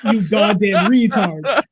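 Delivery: loud, drawn-out jeer; slow loud insult. Retold or imitated. Jeering at trolls, loud and slow. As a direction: spell out the insult slowly and loudly. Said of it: "My response was".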